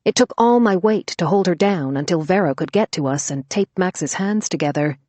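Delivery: usual speaking voice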